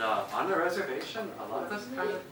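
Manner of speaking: usual speaking voice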